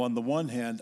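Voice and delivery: ordinary delivery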